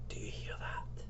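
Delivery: hushed, voiceless whisper. Acted. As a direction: whisper it very softly. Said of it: "you whisper".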